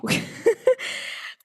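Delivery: airy laugh, high and light; breathy high laugh. Real, not acted. Laugh inside the quoted greeting.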